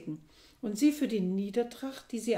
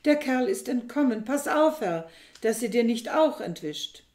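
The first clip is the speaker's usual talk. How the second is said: loud, urgent, higher warning; calling out; 4 semitones higher, 7 dB louder than usual. A narrator's reading. Thisbe warning Aristippos about his wife, loud urgent call. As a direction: call the master to hold on tight. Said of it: "rief sie".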